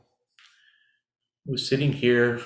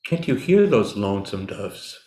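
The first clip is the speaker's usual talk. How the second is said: reading a poem; 3 semitones lower, 4 dB louder than usual. Shown as a demonstration. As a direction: read it like a meditation poem. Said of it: "let me read you a poem".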